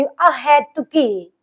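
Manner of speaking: अहेतुकी stretched very slowly, the ए clear; slow model word. Shown as a demonstration. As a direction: sound out अहेतुकी carefully. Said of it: "कहाँ पे याद रखना है?".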